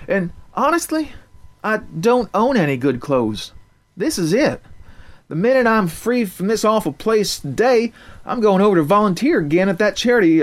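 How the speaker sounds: put-on mild country-girl candor; lightly voiced country girl. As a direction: explain herself, calmed down. Narrator voicing Crystal.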